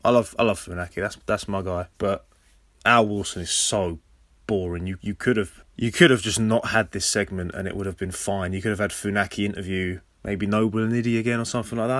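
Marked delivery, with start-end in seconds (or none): none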